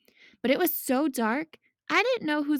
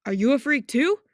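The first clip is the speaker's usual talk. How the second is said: mock bully; 7 dB louder than usual. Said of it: "He was like".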